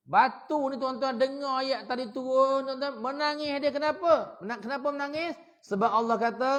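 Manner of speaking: loud, high, impassioned sermon voice; preaching at full force. Acted. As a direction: thunder it at the congregation, building to a peak on the last words.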